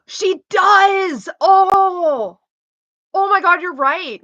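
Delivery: gasping, loud sudden realisation; surprised. Real, not acted. Streamer reacting to chat's Andrew Tate comparison, gasping. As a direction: gasp as it clicks.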